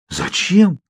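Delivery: truly taken aback; genuine surprise. A narrator's reading. Bella hears Edward watches her nightly, astonished. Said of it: "Удивление в моём голосе было неподдельным".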